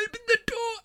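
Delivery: high, soft, pitiful plea; pleading little voice. Retold or imitated. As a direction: beg meekly to be let out. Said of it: "And I was like".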